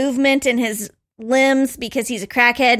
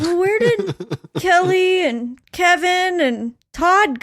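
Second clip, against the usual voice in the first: clueless mom; 6 semitones higher, slower than usual. Retold or imitated. Mom asks about friends.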